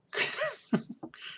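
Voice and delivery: high, breathless quiet laugh; wheezing giggle fit. Real, not acted. Reader unable to finish credits line, laughing.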